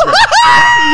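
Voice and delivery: high-pitched burst of laughter. Real, not acted. Comic laughs telling podcast hosts the story, high burst of laughter. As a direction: crack up loudly.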